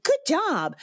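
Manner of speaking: high, hollow cheerleading; empty praise. Acted. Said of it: "instead of saying an empty".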